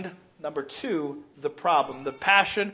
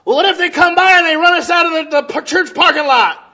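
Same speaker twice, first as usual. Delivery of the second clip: loud, high preaching; preaching at full force; 11 semitones higher, 11 dB louder than usual. Acted. Pastor preaching hard to his church, loud and high. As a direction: raise the voice over the congregation.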